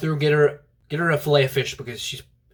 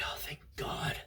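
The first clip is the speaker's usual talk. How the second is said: hushed, whispered relief of a retold janitor; relieved; 17 dB quieter, slower than usual. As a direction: let the mock relief out in a whisper. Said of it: "he was actually relieved".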